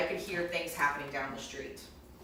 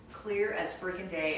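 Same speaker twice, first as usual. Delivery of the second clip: ordinary talk, nothing marked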